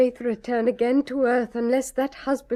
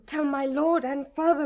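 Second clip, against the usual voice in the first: usual speaking voice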